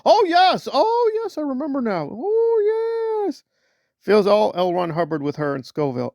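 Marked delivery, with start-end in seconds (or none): 0.1-3.4 mock blissful believer. Mocking a Scientology believer.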